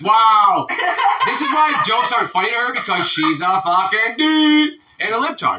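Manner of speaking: high, loud teasing rant; laughing exclamation. Real, not acted. Joke teller teases the woman who didn't laugh, loud laughing rant. Mock outrage at unamused listener.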